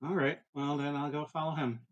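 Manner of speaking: ordinary delivery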